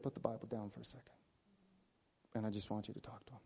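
a gentle voice inside his head; inner voice. Retold or imitated. Holy Spirit guides the pastor, hushed and soft.

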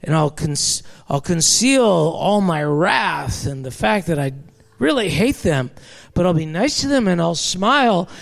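mock a sugary people-pleaser; hammed-up falsely sweet church voice; fake-nice voice. Shown as a demonstration. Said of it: "we learn to speak like this".

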